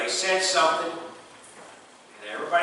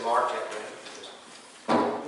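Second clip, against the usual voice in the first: deadpan dad reply; 7 semitones lower, 7 dB quieter, much slower than usual. Retold or imitated. Dad's deadpan reply to son.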